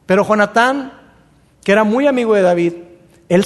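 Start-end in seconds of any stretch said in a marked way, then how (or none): none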